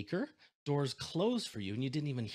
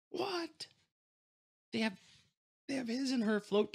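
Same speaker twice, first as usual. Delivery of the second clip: disbelief; 13 semitones higher than usual. Real, not acted. Disbelief at his and her planes.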